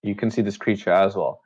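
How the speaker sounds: normal talking voice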